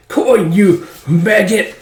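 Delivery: high, slow, drawn-out insult; outraged high-pitched villain; furious villain curse. Acted. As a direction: spit out the insult slowly.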